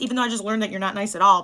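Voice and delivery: normal talking voice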